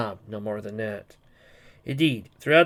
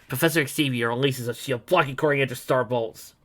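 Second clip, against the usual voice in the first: usual speaking voice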